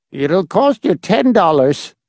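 put-on high, slow, stingy old voice; name the price, miserly; grasping old man. A narrator's reading. The little man charges the visitors, high and miserly.

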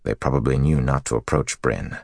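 ordinary delivery, nothing marked

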